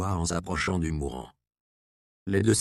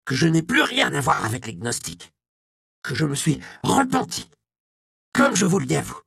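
put-on higher voice of a repentant old monk; old defrocked monk, pleading; 5 semitones higher, 3 dB louder than usual. Monk answering the inquisitor about the Gnostics, anxious and higher. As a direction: justify yourself in a higher, anxious voice.

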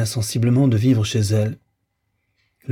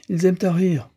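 a maid's remark, lightly voiced and pitched up; remarked aloud; 8 semitones higher than usual. A narrator's reading. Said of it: "remarqua tout haut Catherine". (remarque à voix haute, ton plus aigu)

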